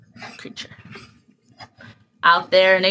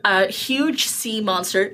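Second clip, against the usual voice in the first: normal talking voice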